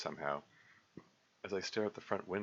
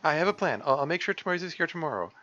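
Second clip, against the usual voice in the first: earnest, stammering, louder comfort; reassuring high girl voice; 10 semitones higher, 6 dB louder than usual. Acted. Sayori kneeling to comfort crying Monica, earnest reassurance. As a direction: promise to fix it, urgently.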